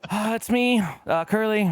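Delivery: lightly voiced trapped in the stall; sheepish self. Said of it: "And I'm like".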